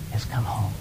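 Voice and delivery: whispered reverent close. Acted. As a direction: breathe the words as a secret. Father's climactic tender line.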